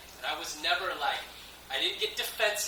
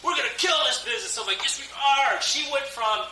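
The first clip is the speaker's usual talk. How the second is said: excited; 9 semitones higher, 6 dB louder than usual. Retold or imitated. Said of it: "She's like".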